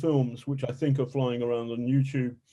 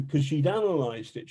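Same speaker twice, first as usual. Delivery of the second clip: ordinary talk, nothing marked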